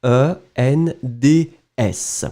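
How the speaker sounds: slow letter-by-letter spelling. Shown as a demonstration. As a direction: spell each letter clearly. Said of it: "So it's attend".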